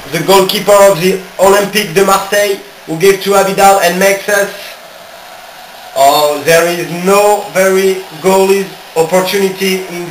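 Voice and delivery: fast-flowing football commentary full of oh's; excited match commentator calling the game; live play-by-play. Acted. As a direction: call the action as it happens.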